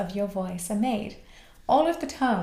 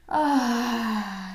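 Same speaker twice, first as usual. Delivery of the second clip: voiced sigh. Shown as a demonstration. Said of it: "let out the tension through vocalization".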